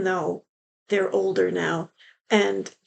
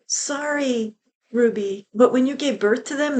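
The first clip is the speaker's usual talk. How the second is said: ordinary delivery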